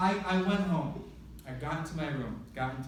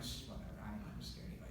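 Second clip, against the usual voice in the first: scared whisper, much softer; 6 semitones lower, 13 dB quieter, faster than usual. Retold or imitated. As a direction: hush it like you're afraid of being heard. Hushed retort while scared.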